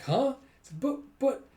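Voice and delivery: high, loud yelp of surprise; startled reaction. Retold or imitated. Koyomi hearing Walsh will take the dog, acted surprise. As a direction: pitch jumps up in disbelief.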